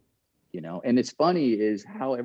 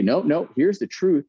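higher, louder and slow, slapping down proof; mock showdown to the press with the article; triumphant retort; 7 semitones higher, 6 dB louder than usual. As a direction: pull out the proof with a flourish. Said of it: "I'm just gonna say".

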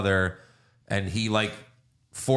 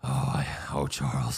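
lower, breathy mock swoon; mock swooning Eric; 10 semitones lower, 4 dB quieter than usual. Host teasing Eric's feelings, a lower mock swoon. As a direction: sigh out his name lovingly. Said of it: "he gets like".

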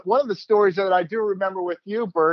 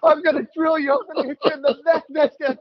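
retold screaming threat; 7 semitones higher, faster than usual. Broadcaster retelling pitcher screaming at Canseco, mock yell. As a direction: yell it at the batter like a furious pitcher. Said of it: "screaming at the top of your lungs".

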